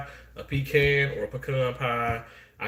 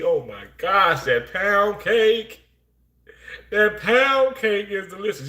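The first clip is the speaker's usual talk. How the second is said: high, slow swooning over the pound cake; drawn-out high praise of the cake; high, slow, delighted cake praise; savoring delight; 7 semitones higher, 4 dB louder, slower than usual. Real, not acted.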